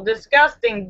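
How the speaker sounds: usual speaking voice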